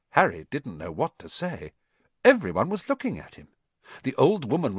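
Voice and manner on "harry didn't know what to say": ordinary delivery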